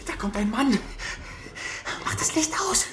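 high, urgent hushed cry; alarmed warning. Acted. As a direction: warn your accomplice in a panic, high and tense. Boys on watch, man approaching. (erschrockene, dringende Warnung)

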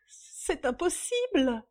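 high, slow, shocked denial; stunned disbelief. A narrator's reading. Young woman refusing the news, stunned. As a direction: draw it out in disbelief. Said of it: "la jeune femme anéantie".

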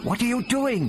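high, urgent question; calling out. A narrator's reading. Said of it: "Barbara called".

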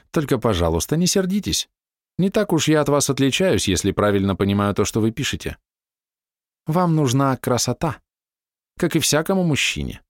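young woman voiced. A narrator's reading.

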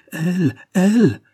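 high, loud and slow; anguished cry. A narrator's reading. Henri crying out against the slander of his love, loud and high. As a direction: cry it out, refusing to believe. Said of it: "cria Henri".

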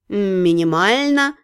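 slow, low, bored drawl; lazy bored demon cat, very slow; snooty bored cat. A narrator's reading. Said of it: "возразил демон".

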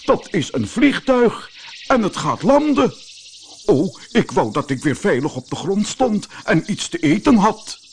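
high, anxious cry; alarmed professor. Acted. Professor in midair spotting a plane, high and alarmed. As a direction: panic at the landing plane.

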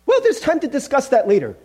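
high-pitched, hurried brush-off; evasive ghost. Acted. Ghost putting off the angel's question, evasive.

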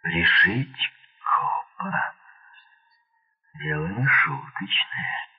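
drawn-out words, pitch swinging up; slow, marvelling deliberation; musing realisation. A narrator's reading. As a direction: stretch the words as it dawns on you. Hogan muses, works it out.

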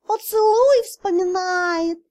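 high, slow gleeful cat; cat spirit teasing the blushing girl. Acted. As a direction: tease her like a sly cat. Cat teases.

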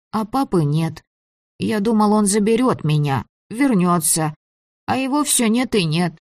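put-on high child voice, sad; a lonely boy missing his father; sad little boy.

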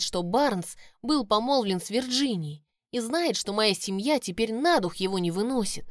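ordinary delivery

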